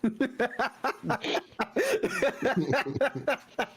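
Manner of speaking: high real laugh. Real, not acted. Group laughter.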